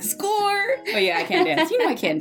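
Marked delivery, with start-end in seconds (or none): 0.0-0.8 triumphant. Retold or imitated. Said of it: "It's like".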